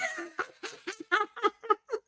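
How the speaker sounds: high, breathless giggle; laughing. Real, not acted. Laughing at her own story.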